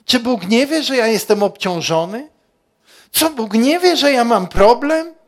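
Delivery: mock a hurt believer working himself up; building from mild gripe to loud high protest; complaining whine. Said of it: "Czy mówisz".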